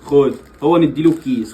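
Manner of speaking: ordinary delivery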